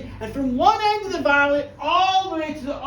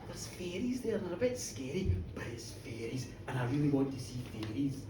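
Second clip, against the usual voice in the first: put-on wee boy's hushed wonder; 12 semitones lower, 13 dB quieter than usual. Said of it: "is thinking".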